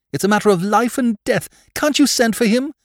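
urgent plea in a high woman's voice; distraught lady pleading for help; desperate cry. A narrator's reading. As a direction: beg frantically, pitched far up. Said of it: "cried Lady Mary".

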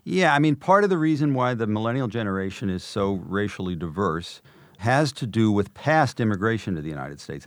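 ordinary delivery

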